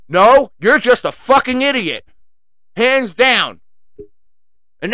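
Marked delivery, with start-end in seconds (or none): 0.1-3.6 angry retort. Real, not acted.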